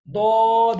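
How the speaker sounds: "दौद" loud and heavy, the side of the tongue on the molars; heavy letter demo. Shown as a demonstration. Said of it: "Next one is". (ज़ोर से भारी "दौद")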